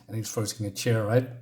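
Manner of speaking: ordinary delivery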